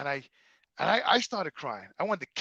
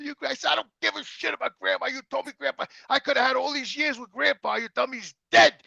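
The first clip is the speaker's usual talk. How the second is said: higher, hurt and furious; angry accusation; 8 semitones higher than usual. Retold or imitated. Son blames mother for hiding grandpa, angry. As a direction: rage at the years lost.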